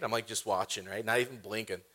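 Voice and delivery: ordinary talk, nothing marked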